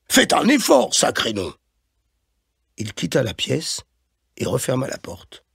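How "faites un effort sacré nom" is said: high-pitched burst, dropping on the oath; exasperated scolding. A narrator's reading. Boss reproaches Claude over punctuality, exasperated. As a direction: snap impatiently at an employee.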